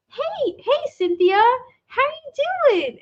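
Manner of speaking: high, sing-out cheery greeting; D W's happy smile. Retold or imitated. Cartoon kid greeting a visiting friend, happy-smile voice. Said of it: "D W just straight face, happy smile goes".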